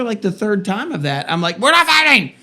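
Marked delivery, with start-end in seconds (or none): 1.6-2.3 much higher and louder exasperated retort; hammed-up frustrated parent, pitched way up. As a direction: snap back at the kids in mock exasperation. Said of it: "so you can get frustrated".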